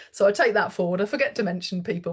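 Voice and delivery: ordinary talk, nothing marked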